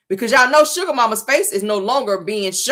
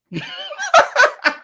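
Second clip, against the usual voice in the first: high cackle. Real, not acted. Laughing at own bit.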